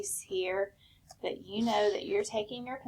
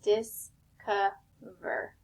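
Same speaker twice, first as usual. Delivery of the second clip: discover spaced slowly as dis-co-ver; slow syllable-by-syllable discover; tapped out by syllable; much slower than usual. Shown as a demonstration. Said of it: "you can also tap it out".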